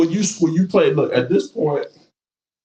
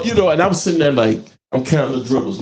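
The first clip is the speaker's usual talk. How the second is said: ordinary delivery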